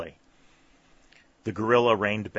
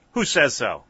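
loud, higher challenge; defiant exclamation; 5 semitones higher, 8 dB louder than usual. A narrator's reading. Skelly challenges the sergeant's accusation, defiant. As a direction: throw it back defiantly. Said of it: "exclaimed Skelly defiantly".

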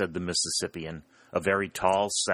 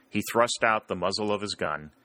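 ordinary delivery, nothing marked

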